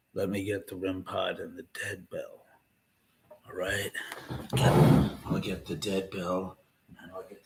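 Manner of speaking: low, quiet lightly gravel-free impression; hint of a low, hushed Lance Henriksen. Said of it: "I'm gonna do a Lance Henriksen".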